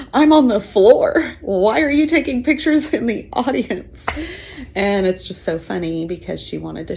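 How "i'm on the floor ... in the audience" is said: louder, higher, slowed exasperated question; exasperated daughter. Retold or imitated. Cheerleader daughter scolding her mom, exasperated and loud. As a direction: complain to your mom in disbelief.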